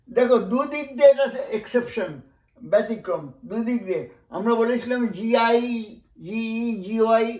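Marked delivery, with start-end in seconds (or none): none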